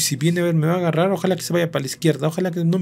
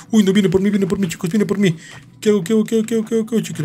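high, rapid-fire frantic repeats; panicked; 5 semitones higher, faster than usual. Real, not acted. Gamer asking viewers what to do while chased, panicked. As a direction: babble higher as the chaser closes in.

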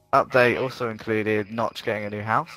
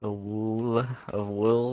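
very slow, drawn-out words; slow drawn pun; 4 dB quieter, much slower than usual. Pretend. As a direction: stretch every word out slowly. Punning on wool.

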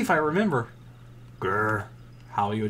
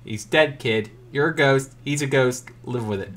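ordinary talk, nothing marked

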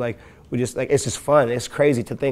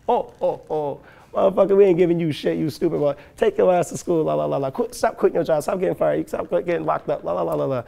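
a hint of a laughing, scolding dad, higher; lightly voiced dad laughing him off; mocking laughing dad; 4 semitones higher than usual. Dad laughing at his son's plan, mildly mocking. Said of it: "he was like".

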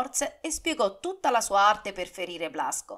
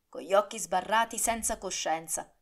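ordinary delivery

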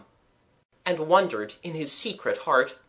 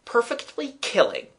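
higher, firmly cheerful assertion; resolute; 9 semitones higher than usual. A narrator's reading. Boy insisting to his doubtful friend it is fun, resolute. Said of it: "Gerald asserted resolutely".

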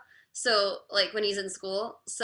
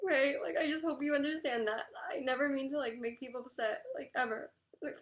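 real crying, pitched up; tearful; 6 semitones higher than usual. Real, not acted. Streamer apologizing to upset viewers, tearful. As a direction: plead through tears to be understood. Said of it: "Why am I so emotional?".

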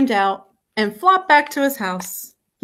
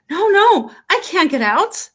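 put-on high, raised childlike protest; alarmed refusal; 7 semitones higher than usual. A narrator's reading. Froggy refusing his mother, high alarmed protest.

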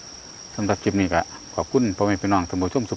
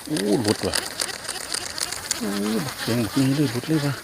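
higher, groaning dismay as it slips off; dismayed cry; 7 semitones higher, 4 dB quieter than usual. Real, not acted. Angler watching his fish slip away, dismayed. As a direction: let the loss burst out.

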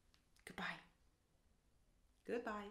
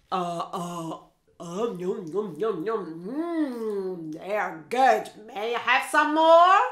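Caribou munching; 3 semitones lower, 6 dB louder, slower than usual. Acted. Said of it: "goes Caribou".

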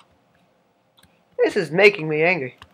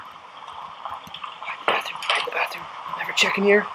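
urgent whisper; 3 semitones higher, 7 dB quieter than usual. Acted. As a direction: hiss it under your breath. Hiding from the owner.

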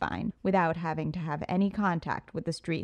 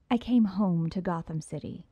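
ordinary delivery, nothing marked

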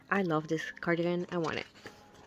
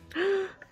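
short, high gasp; awestruck gasp; 10 semitones higher than usual. Real, not acted. Fan seeing a superior look on her idol, awestruck gasp. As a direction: suck in air, stunned by the sight.

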